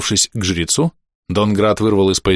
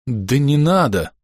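жалостливо; 3 semitones higher, slower than usual. A narrator's reading. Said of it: "жалостливо пробубнил Донград".

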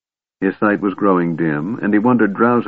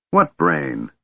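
lower, lightly snarled; a mild sneering snarl; 4 semitones lower than usual. A narrator's reading. Draconian guard mocking Caramon about the Kender, lower mild snarl. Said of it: "snarled the Draconian".

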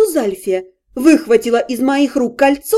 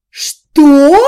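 loud, sharp bark; aunt bellowing at the cheating man; 6 dB louder than usual. A narrator's reading. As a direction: snap it out like an outraged aunt. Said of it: "рявкнула тётя".

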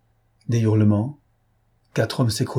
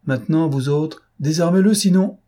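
raised, high-pitched commanding threat; shouted threat; 6 semitones higher, 4 dB louder than usual. A narrator's reading. Lupin orders the bandits to disarm their chief, shouted threat. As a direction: order them sharply, louder to the end.

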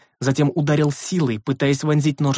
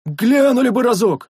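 much higher, louder whining yell; pleading wail; 10 semitones higher, 5 dB louder than usual. A narrator's reading. The sentry wails to Koris, pleading over his sword. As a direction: wail up at the master.

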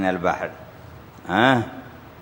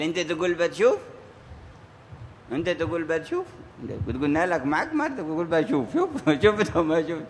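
teasing reply; 5 semitones higher, 5 dB quieter than usual. Retold or imitated. Retold reply teasing the puzzled caller.

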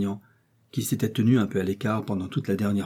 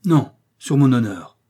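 loud protest, louder; exclaiming; 3 semitones higher, 8 dB louder than usual. Acted. Guard swears loudly to Aramis, louder. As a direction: cry out on his honour. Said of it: "s'écria le garde".

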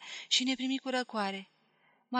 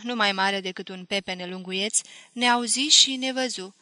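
plain reading voice

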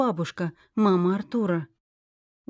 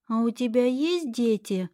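put-on curious little girl; 3 semitones higher than usual. A narrator's reading. Child asks a question.

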